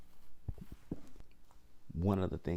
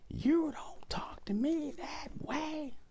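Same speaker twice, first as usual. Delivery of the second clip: very high, slow mock scream; demonstration yell; slower than usual. Shown as a demonstration. Showing Kim how it would carry through thin walls, demonstration yell. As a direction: stretch it out in a strained, high-pitched holler. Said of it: "Now, if I yelled".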